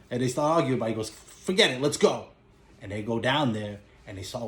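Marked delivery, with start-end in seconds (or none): 1.1-2.3 a sudden raised bark, then it settles; retelling a mobster's loud let's-go; impatient shout. Retold or imitated. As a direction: cut the argument short, loudly. Drunk gangster urging the attack.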